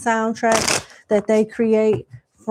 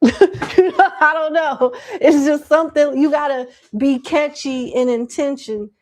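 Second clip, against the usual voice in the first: laughing; 6 semitones higher, 3 dB louder than usual. Real, not acted. Laughing after bad bitch line.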